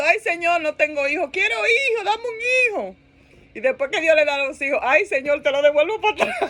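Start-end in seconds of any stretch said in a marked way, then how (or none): 0.0-3.0 high-pitched, hammed-up wailing; mock crying plea. Childless woman begging the Lord for a baby, mock crying. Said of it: "Llorando y gritando". 4.8-6.4 comic lament dissolving into laughter; plead, then crack up on the end; laughing mock plea. Worn-out parent giving children back to God, laughing mock plea.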